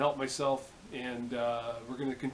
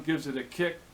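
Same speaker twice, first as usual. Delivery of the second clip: ordinary delivery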